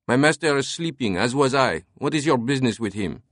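put-on French-accented servant, irritated. A narrator's reading. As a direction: answer curtly in a French accent. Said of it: "an irritated French accent spoke".

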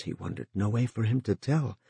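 ordinary delivery

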